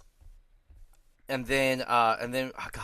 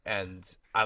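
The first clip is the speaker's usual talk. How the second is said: ordinary delivery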